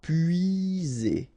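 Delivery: slow, stretched "épuisé"; drawn out. Shown as a demonstration. French teacher modelling for learners, "épuisé" slow and drawn out. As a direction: draw out each sound of "épuisé".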